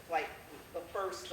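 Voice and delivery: usual speaking voice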